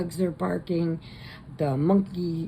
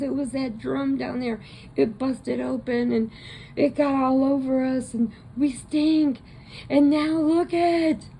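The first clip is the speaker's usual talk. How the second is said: panicked explaining; 9 semitones higher than usual. Retold or imitated. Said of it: "And he goes".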